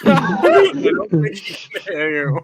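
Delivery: laughing. Real, not acted. Laughing at tech failure.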